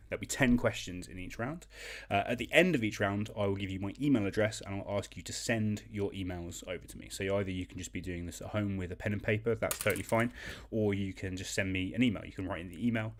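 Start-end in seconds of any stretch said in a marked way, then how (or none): none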